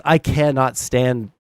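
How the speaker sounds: ordinary talk, nothing marked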